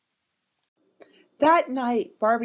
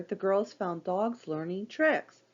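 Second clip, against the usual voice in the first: ordinary delivery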